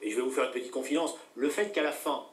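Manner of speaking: ordinary delivery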